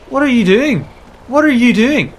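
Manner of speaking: high, loud, incredulous scolding; exasperated. Real, not acted. Player berates someone in the game, loudly exasperated. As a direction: call them out, each question falling hard at the end.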